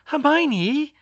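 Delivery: very high, loud name call; exasperated cry. Acted. Georgina interrupts Hermione's calm analysis, exasperated cry. Georgina exclaims at detached explanation.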